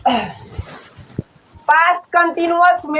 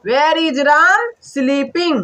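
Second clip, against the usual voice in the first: slow dictation; much slower than usual. Shown as a demonstration. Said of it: "sleep में ING लगा देंगे".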